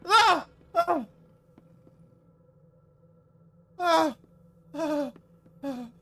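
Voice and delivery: high frightened screaming; terrified screams. Real, not acted. Jump scare in horror game.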